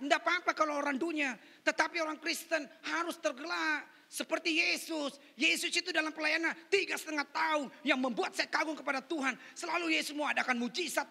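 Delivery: full-force pulpit preaching; loud, emphatic sermon delivery. Real, not acted. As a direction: proclaim it loudly to the whole congregation.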